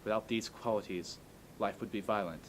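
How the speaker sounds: formal speech. Acted. Recites Chaplin's speech calmly.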